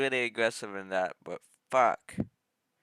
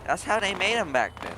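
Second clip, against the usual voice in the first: high, loud and eager; excited; 9 semitones higher, 7 dB louder than usual. Real, not acted. Enthusiastic car remark.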